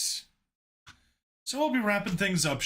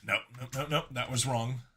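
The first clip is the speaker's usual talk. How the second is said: plain reading voice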